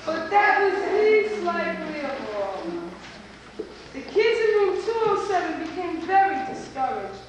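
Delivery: ordinary delivery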